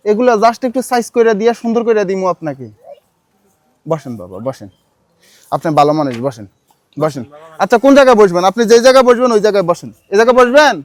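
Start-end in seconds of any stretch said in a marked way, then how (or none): 3.9-7.2 much lower and softer, tender; gentle coaxing. Real, not acted. Gently seating the man.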